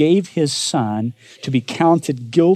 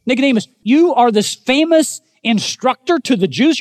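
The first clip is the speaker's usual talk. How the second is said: raised, ringing rebuke of a teacher; a preacher voicing Jesus' rebuke, pitched higher; Jesus rebuking; 10 semitones higher, 3 dB louder than usual. Jesus rebukes the famous teacher Nicodemus, mock-incredulous and raised. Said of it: "Jesus is rebuking Nicodemus".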